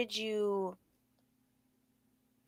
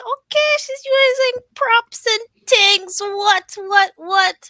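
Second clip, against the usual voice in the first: high, stunned disbelief; shocked; 10 dB louder, slower than usual. Retold or imitated. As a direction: squeal it out in amazement. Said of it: "like, so shocked".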